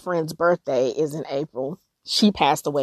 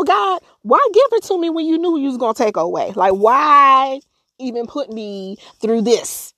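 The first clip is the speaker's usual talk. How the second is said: retold angry plea; 7 semitones higher, 4 dB louder than usual. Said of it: "I was like".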